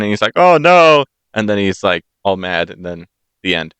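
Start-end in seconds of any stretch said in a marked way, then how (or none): 0.4-1.0 high, loud hammed-up cry of defeat; mock dismay. Storyteller voices Rumpelstiltskin hearing his name guessed, mock-dismayed. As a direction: imitate a villain who has been foiled.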